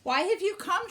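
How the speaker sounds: sister's challenge. A narrator's reading. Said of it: "she asked".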